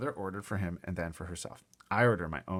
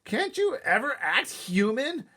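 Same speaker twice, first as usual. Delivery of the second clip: exasperated yell; 6 dB louder, slower than usual. Acted. Peter yelling at Fudge.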